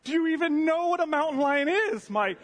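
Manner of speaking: loud, high-pitched incredulous outburst; exasperated retold yell. Terrified camper to sleepy partner offering earplugs, exasperated yell. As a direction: yell it in comic disbelief, voice shooting up. Yelling at unbothered partner in story.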